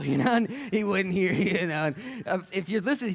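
high, loud, laughing delivery; cracking up mid-story. Real, not acted. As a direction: tell it through real laughter.

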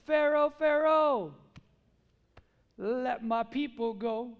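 slow, drawn-out call to a ruler; imitating a prophet's grand command; booming proclamation. Acts Moses commanding Pharaoh.

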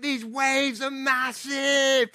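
imitating an excited British little boy. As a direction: play the amazed child, stretching the last word. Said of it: "He got so excited".

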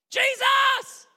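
high, loud holler; desperate shout. Acted. Blind beggar calling Jesus again, shouting. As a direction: cry out over the crowd. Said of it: "he begins shouting".

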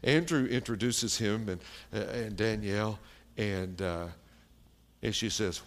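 normal talking voice

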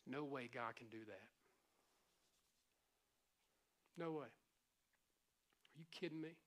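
a low, quiet, slow taunting voice; mocking enemy. Pastor voices the enemy's doubts, low and mocking. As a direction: sneer the doubts slowly and softly.

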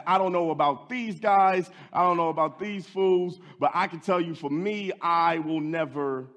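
loud, high boast; bold boasting Peter. Acted. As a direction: brag loudly to the room. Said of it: "put the other disciples on blast".

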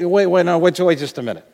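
usual speaking voice

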